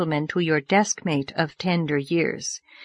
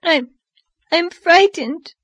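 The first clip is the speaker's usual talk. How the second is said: put-on high, halting little-girl sob; tearful sobbing; 9 semitones higher, 3 dB louder, slower than usual. A narrator's reading. Dora confessing her fear at bedtime, sobbing. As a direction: act a frightened child choking out words through tears. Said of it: "she sobbed".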